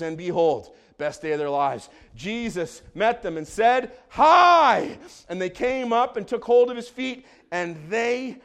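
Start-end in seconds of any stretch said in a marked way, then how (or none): 4.1-5.0 high, loud, drawn-out hello; exuberant acted greeting. Jesus greeting the women at the tomb, exuberant shout. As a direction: boom it out with a high swoop.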